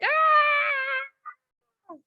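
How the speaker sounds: sudden shriek, pitched very high; a high, wild cry; wild scream. Retold or imitated. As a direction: let out a high shriek as if bursting in. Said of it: "knock your door down and be like".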